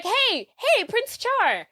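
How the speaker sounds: put-on high starstruck teen calling the prince; eager fangirl call. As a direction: squeal his name like a starstruck fan.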